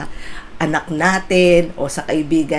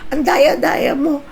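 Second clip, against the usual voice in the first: whiny complaint; 8 semitones higher than usual. Retold or imitated. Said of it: "Sabi ko".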